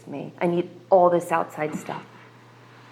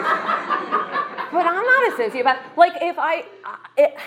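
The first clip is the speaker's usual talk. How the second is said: laughing through it, high and slow; rueful laugh about her own past; 10 semitones higher, slower than usual. Real, not acted. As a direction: laugh it off, drawn out.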